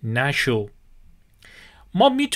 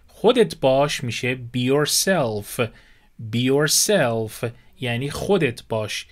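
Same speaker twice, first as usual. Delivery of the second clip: ordinary delivery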